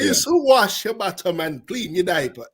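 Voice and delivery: hammed-up Jamaican family outrage; half Jamaican accent. Shown as a demonstration. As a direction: protest like an indignant Jamaican relative. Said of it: "say this in my half Jamaican accent".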